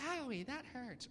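small, high, soft ouch; playfully put-on owie; mock minor pain. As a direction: make light of a tiny burn. Acting a small burn from a grill.

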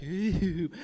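low mock-spooky ooh; a playful haunted-house moan. To the youth group, joking the series isn't scary, a mock-spooky ooh. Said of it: "it's not gonna be scary".

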